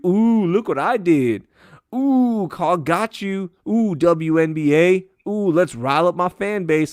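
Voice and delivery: high, slow sneering mimicry of a smug politician; mock them with a taunting, preening sing-song; a politician gloating on Twitter.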